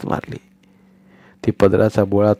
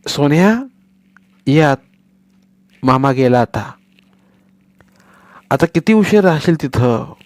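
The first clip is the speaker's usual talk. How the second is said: calling out; 5 semitones higher than usual. Acted. Mother calls son inside.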